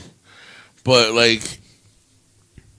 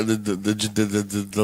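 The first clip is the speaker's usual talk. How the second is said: stuck repeating the word; stammering on the; stutter; 5 semitones lower, 9 dB quieter than usual. Real, not acted. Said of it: "I stuttered a lot".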